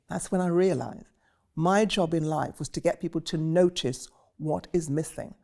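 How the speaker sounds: ordinary talk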